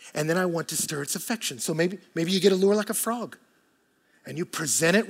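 usual speaking voice

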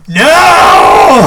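drawn-out, very loud "no"; long shouted refusal. Real, not acted. Partner vetoing a pull-up bar in the garage, shouting. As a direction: yell it out, stretching the vowel.

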